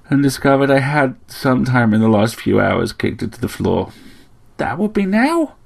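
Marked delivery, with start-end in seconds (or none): 4.6-5.5 higher-pitched little bird voice; chirping sparrow. A narrator's reading. Sparrow fusses over Slayle's injuries, chirping. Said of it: "chirped the sparrow".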